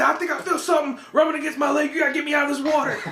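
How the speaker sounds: high, fast hammed-up panic; dad feeling something in the water, high mock fright; freaking out. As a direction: rush it high like a frightened man. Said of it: "He was freaking out".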